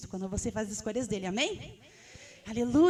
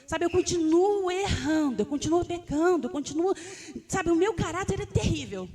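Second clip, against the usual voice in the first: anguished lament; 6 semitones higher, 8 dB louder than usual. Retold or imitated. A believer crying out to God that nothing changes. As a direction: wail it out like confessing your failures.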